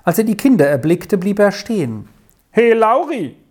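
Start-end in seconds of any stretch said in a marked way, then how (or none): 2.5-3.4 a stranger's loud hail across the meadow; higher, louder call to the boy. A narrator's reading. Man on the path calling to Lauri, hailing loudly. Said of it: "rief er".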